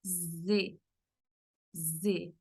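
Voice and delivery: Z held as a long voiced buzz. Shown as a demonstration. Teacher demonstrating the Portuguese z sound, buzzing Z. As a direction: let the Z buzz through closed teeth. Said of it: "este sonido que hace".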